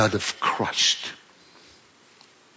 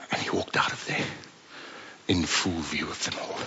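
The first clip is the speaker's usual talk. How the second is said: softer, voiceless, hushed; whispered storytelling; 8 semitones lower, 11 dB quieter than usual. A narrator's reading. Preacher narrating the healed man leaving, whispered. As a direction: tell it in a hush.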